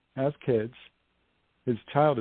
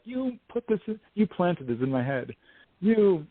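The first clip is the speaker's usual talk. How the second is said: angry accusation; 7 semitones higher than usual. Retold or imitated. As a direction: accuse her, outraged. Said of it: "He was mad at this hypnotist".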